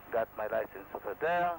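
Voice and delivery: ordinary talk, nothing marked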